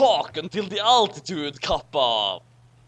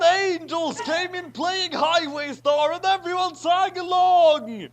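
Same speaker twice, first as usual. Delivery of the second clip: excited; 10 semitones higher, 6 dB louder than usual. Acted. Excited high narration.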